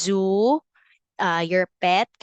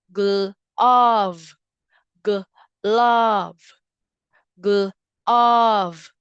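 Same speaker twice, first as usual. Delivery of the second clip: "Glove" said louder, each time set apart; teacher saying "Glove" louder for the class; emphatic modeled word; 5 dB louder, much slower than usual. Shown as a demonstration. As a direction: punch out "Glove" so kids hear the V. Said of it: "It's at the end".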